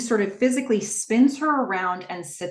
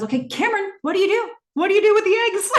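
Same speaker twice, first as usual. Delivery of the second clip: high, louder pressing question; pressing quiz; 13 semitones higher, 5 dB louder, much faster than usual. Retold or imitated. Tom pressing Cameron to solve the puzzle, high and louder. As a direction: push her for the answer, high. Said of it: "And then he says".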